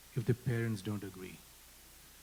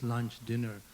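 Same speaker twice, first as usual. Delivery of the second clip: normal talking voice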